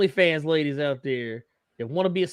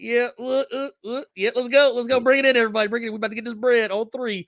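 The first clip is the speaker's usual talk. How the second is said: higher, hyped-up huddle pep talk; rally the team, pitched up; halftime pep speech; 8 semitones higher, faster than usual. Retold or imitated. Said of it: "doing a little".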